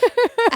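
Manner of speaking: high loud burst of laughter; shrieking laugh. Real, not acted. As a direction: cackle with delight.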